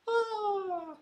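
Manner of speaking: high squeaky mock cry of pain; banana's dying squeal. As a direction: let out a tiny high-pitched death squeal. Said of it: "goes the banana".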